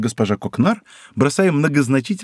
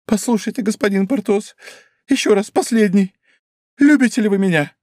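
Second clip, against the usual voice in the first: higher, earnest last plea; pleading wife; 8 semitones higher than usual. Acted. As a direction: ask him one final time. (последняя мольба, выше)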